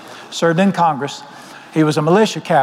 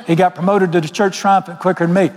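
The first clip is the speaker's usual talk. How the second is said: normal talking voice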